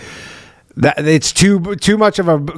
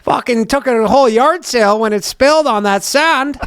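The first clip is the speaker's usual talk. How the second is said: high-pitched, hammed-up Western accent; mock Western accent; 9 semitones higher than usual. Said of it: "and he's like".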